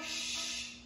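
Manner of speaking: whispered shh; soft hushing sound. Real, not acted. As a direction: hush it out on breath. Hushing after the outburst.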